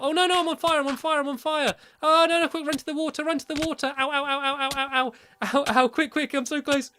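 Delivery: high, hurried cries of alarm; frantic, repetitive yelps as if burning; playful panic. Pretend. Gamer on fire in the game races for water, panicked.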